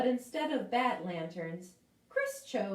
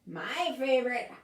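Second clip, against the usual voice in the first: higher, relishing the scary words; gleeful spooky pumpkin; 6 semitones higher than usual. Acted. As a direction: savour howling and screaming. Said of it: "said Gnarly the Pumpkin".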